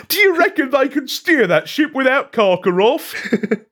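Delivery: high voice, cracking with laughter; laughing through a quote. Acted. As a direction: read it while giggling.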